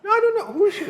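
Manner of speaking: genuinely baffled, ask high; real surprise; surprised high question. Real, not acted. Guest asks who the actress is.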